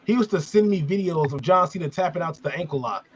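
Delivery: ordinary talk, nothing marked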